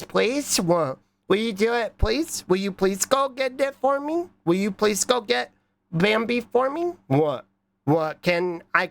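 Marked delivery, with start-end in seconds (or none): 0.0-0.6 higher-pitched cutesy plea; cute pleading. Pretend. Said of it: "Now try doing it cutely". 1.3-6.9 high, cutesy begging voice; cute pleading. Pretend. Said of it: "Now try doing it cutely".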